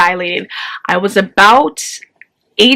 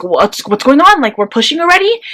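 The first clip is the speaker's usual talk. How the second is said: much higher on pushing already; mock the doctor's startled disbelief; doctor walking in to find pushing already begun, startled; surprised doctor; 7 semitones higher than usual.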